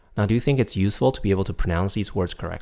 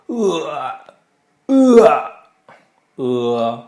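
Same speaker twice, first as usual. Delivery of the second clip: luide kokhalzende "uh", veel hoger; mock barfing uh; 6 semitones higher, 8 dB louder, much slower than usual. Shown as a demonstration. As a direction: doe alsof je overgeeft op "uh". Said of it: "imagine that they're barfing".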